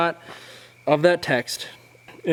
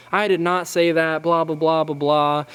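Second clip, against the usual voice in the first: whiny dad denial; 4 semitones higher than usual. Retold or imitated. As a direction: deny it like a whiny, defensive dad. Imitating the dad denying it.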